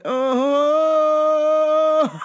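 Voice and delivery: long, high, held oh; mock wail. Worker reacting to the stalker's blessing, mock wail. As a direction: hold one high cry of dismay. Acted long groan of dismay.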